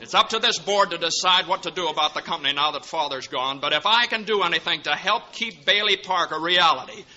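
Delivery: rally the board behind you; rousing defiance. Acted. George's rousing stand.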